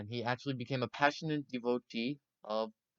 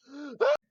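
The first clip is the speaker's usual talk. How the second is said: sharp gasp; 5 dB louder than usual. Acted. Mock shock after quote.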